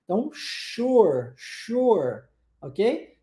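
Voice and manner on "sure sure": slow "sure" opening on a long "sh"; "sure" with its S like Portuguese X. Shown as a demonstration. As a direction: model "sure" as a drawn-out "shoor". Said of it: "O S com som de X".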